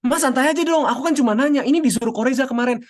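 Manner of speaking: put-on a kid's high-pitched "relax, I'm only asking"; high pleading teen. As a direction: whine it back at mom high and pleading. Child answering an irritated parent.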